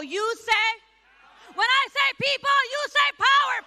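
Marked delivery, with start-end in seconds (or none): 1.5-3.6 loud, high rally call; hyped crowd warm up. Real, not acted. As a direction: pump up the crowd, shouting. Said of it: "so we start with a chant".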